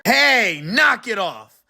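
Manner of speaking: loud annoyed driver; angry. Acted. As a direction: tell him to knock it off. Truck driver angry at honking.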